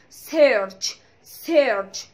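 "سيرش" with a clear final tch; emphasised ch. Shown as a demonstration. Teacher wants CH to show, "سيرش" ending tch. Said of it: "ال سي اتش كده تبان".

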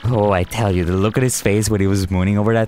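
deep, deferential henchman; low minion voice. Acted. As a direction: grovel to the boss in a low voice. Minions gossiping.

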